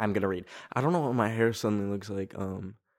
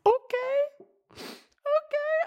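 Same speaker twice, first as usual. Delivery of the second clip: tiny, very high tearful squeak; choked up; much slower than usual. Retold or imitated. Told her giant plastic Minion will be destroyed, choked up. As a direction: agree while holding back tears. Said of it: "I don't know why I'm actually getting emotional".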